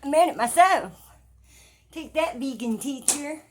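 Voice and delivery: loud, high-pitched gloating; triumphant shout. Acted. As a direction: crow it loudly at a rival. Gloating at an absent vegan teacher.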